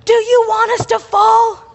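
high, loud panicked yell over the wind; retold yelling. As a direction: shout back at a passenger who won't lean. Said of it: "yelling at me".

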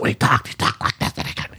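strained, voiceless croaky whisper; lost voice. Retold or imitated. Podcast host imitating a preacher who lost his voice, voiceless rasp. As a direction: force words through a voice that won't come out. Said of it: "a preacher who had lost his voice".

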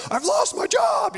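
high, loud panicked cry; panicked outburst. Retold or imitated. Parent panicking in front of a three-year-old, loud high cry. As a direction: blurt it out in sudden panic. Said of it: "you're like".

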